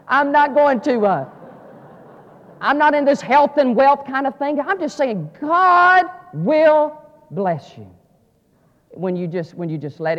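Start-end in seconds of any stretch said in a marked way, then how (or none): 0.1-7.9 loud, high, ringing preacher's call; climbing sermon cry; full-force preaching. Acted. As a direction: preach it out to the back row.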